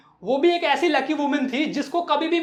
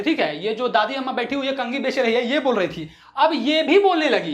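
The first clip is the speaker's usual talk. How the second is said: usual speaking voice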